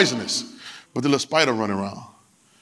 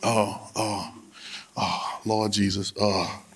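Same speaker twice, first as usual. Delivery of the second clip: moan; 7 semitones lower, 6 dB quieter, much slower than usual. Real, not acted. Preacher moans to the Lord.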